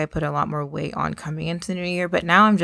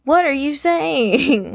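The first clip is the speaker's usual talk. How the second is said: high, slow, drawn-out disbelief; mock bafflement; 9 semitones higher, 3 dB louder, much slower than usual. Questioning people excited about the year, in exaggerated bafflement. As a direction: stretch it out, acting utterly baffled.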